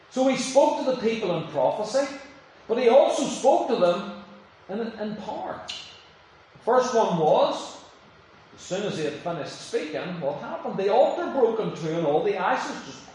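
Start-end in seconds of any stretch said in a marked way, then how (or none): none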